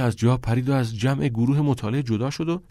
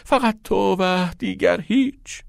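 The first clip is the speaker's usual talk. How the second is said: high, slow mock-devoted plea; boy hamming a proposal on his knees; exaggerated pleading; 9 semitones higher, slower than usual. A narrator's reading. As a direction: overplay the lovesick vow. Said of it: "عجز و لابه کنان آهی مبالغه آمیز".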